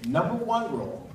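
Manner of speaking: ordinary talk, nothing marked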